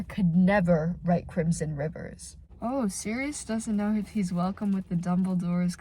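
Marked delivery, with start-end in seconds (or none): none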